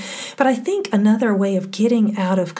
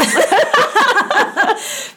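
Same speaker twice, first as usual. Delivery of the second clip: loud laugh; 12 semitones higher, 5 dB louder than usual. Real, not acted. Big laugh at own confession.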